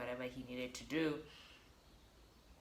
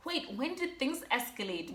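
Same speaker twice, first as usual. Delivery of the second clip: surprised doctor; 10 semitones higher than usual. Retold or imitated.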